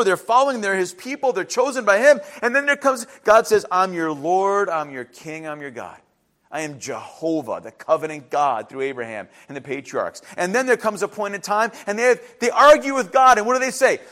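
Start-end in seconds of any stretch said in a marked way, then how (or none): 3.7-10.2 low, softening declaration; solemn God. Acted. God declaring himself to his chosen people, solemn and low. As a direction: state it with quiet authority, pitch dropping.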